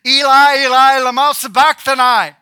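preacher re-enacting an anguished outcry; crying out loudly. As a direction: call it out with full voice. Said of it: "cried out in a loud voice".

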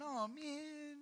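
high, soft mock moan; drawn-out, high-pitched mock letdown; mock dismay. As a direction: imitate a disappointed little whine. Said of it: "the Holy Spirit's not going".

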